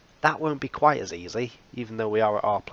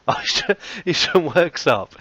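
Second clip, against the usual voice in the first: high and loud through a laugh; cracking up mid-sentence; 8 semitones higher, 7 dB louder than usual. Retold or imitated. Laughing reply in the quote.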